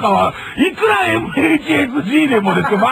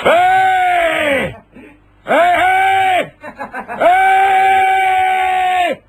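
slow, high, drawn-out calls from the back room; an old man's long, high えーい; old man calling out; 10 semitones higher, much slower than usual. Retold or imitated. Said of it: "みたいな声が聞こえて".

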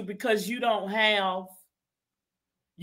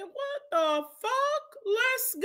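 high, slow, softer mock disbelief; high mock shock; 12 semitones higher, 5 dB quieter, much slower than usual. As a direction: stretch it out high and incredulous. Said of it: "I said".

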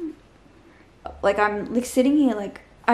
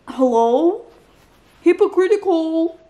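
exasperated verdict; 6 semitones higher, 5 dB louder than usual. Real, not acted. Ranting at a book character.

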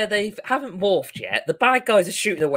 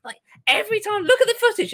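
much higher, fast, incredulous put-on voice; high mock outrage; mock exasperated mentor; 11 semitones higher, faster than usual. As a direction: play her as shrill-voiced disbelief, pitched way up. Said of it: "And she goes".

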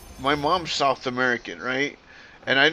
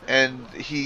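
normal talking voice

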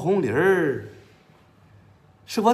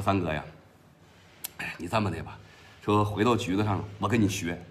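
lower, softer underling reporting to the boss; Huang Qiang's low voice; 9 semitones lower, 8 dB quieter, faster than usual. Acted. The underling answers his boss in a lowered, deferential voice. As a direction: drop the voice down, a deferential henchman.

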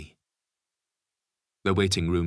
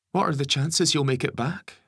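higher worried mother's voice; mum voice; 10 semitones higher, 6 dB louder than usual. Acted. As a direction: voice the anxious wife.

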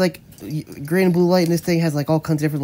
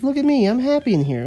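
lightly voiced cat; 5 semitones higher than usual. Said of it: "he says".

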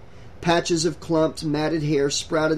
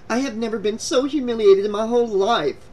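higher-pitched, indignant complaint; humiliated outrage; 6 semitones higher than usual. A narrator's reading. Humiliated girl storms out.

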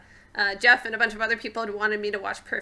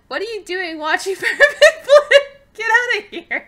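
laughing disbelief; 12 semitones higher, 13 dB louder than usual. Retold or imitated. Shocked at child watching Perfect Blue.

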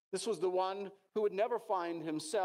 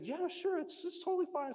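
higher-pitched, easygoing parental yes; agreeable parent; 9 semitones higher than usual. Retold or imitated. Parents letting their daughter stay and play, agreeable and high-pitched. Parents giving child permission in story.

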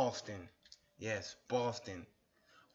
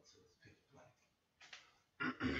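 hushed aside in the dark; 7 dB quieter, much slower than usual. Real, not acted. Lights turned off, hushed.

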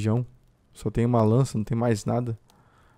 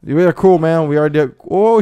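loud high shout; shouting to calm; 4 semitones higher, 10 dB louder than usual. Real, not acted. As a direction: shout across to stop him shooting. Shouting to a player aiming.

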